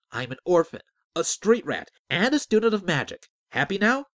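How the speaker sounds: loud, exasperated list climbing in pitch; impatient and angry. A narrator's reading. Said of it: "growing impatient and angry".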